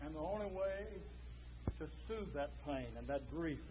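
very soft, low and confiding; hushed preaching. Real, not acted. Preacher explains the one cure for grief, hushed preaching. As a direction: draw the congregation in with a low hush.

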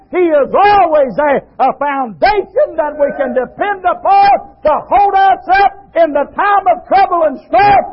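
fiery shouted sermon; preaching. Acted. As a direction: preach it out with full force. Preaches at full force.

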